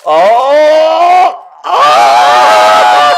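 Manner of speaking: long loud "oh" yell; excited yell. Real, not acted. Friend reacting to a sudden moment, loud excited yell.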